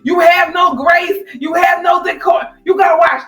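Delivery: high, exasperated imitation of a husband; mock snapping rebuke. Husband scolding his clumsy dancing wife, mock snap. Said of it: "He snaps at the end of the episode".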